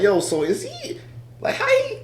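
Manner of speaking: squeaky high, laughing disbelief; baffled retelling. Retold or imitated. Host recalling his confusion at the actor's voice, high-pitched disbelief. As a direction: voice leaps up high in mock confusion.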